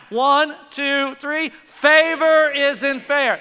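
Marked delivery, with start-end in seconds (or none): 1.5-3.4 slow, louder, rallying the crowd on "Favor isn't fair"; louder call-and-response. Shown as a demonstration. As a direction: lead the congregation, big and slow. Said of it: "you can do better than that".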